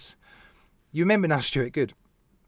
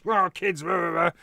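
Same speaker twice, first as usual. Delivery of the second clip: mock childish cheer; 2 semitones higher than usual. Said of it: "This is so childish".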